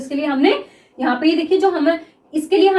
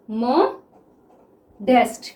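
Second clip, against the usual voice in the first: slow "modest", paused after "मो"; syllable by syllable; 3 semitones lower, much slower than usual. Shown as a demonstration. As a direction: break "modest" into "मो" and "डेस्ट". Repeats written word in pieces.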